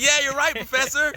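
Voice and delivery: high, eager yes-men chorus; meek class agreeing. Retold or imitated. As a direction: agree with the teacher like cowed students.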